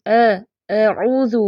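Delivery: "اعوذ" opening on a light short a, between aa and ay; in-between vowel. Shown as a demonstration. Teacher modelling the balanced reading, in-between vowel. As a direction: keep the start of "اعوذ" midway, then rounded oo. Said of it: "درمیانی کیفیت میں دیکھیں".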